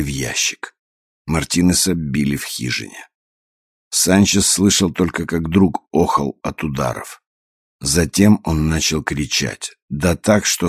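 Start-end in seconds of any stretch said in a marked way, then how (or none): none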